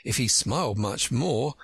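usual speaking voice

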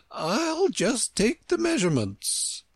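high-pitched queen voice; businesslike Queen. A narrator's reading. Queen about to measure the ground, high queenly voice.

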